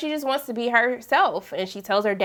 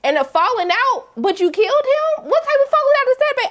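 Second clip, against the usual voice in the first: climbing much higher and quicker; incredulous rant; 12 semitones higher than usual. Real, not acted. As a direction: build up disbelief. Riffing on a murder reveal.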